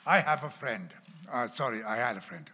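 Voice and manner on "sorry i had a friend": ordinary delivery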